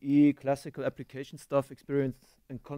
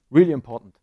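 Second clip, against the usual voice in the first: mock importance; 13 dB louder, faster than usual. Real, not acted. Ironic aside about billing tool.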